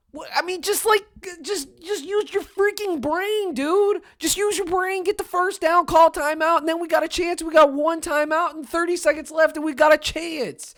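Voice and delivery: high-pitched pleading rant; frustrated high rant. Real, not acted. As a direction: beg him to use his brain, voice cracking up.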